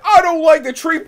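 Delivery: put-on high, loud whiny kid voice with a laugh; scared kid. Voicing the kid scared of the tree, high and whiny. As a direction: squeak it like a frightened child. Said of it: "It's like".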